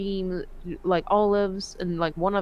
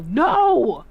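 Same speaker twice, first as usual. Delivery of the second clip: retold yell; 2 semitones higher, 7 dB louder than usual. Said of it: "before I could fucking yell".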